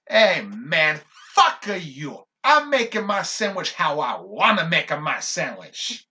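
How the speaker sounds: loud, slow mock shout at a stranger; retold angry shout. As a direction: yell it back like an entitled regular. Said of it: "He shouts that. He screams it".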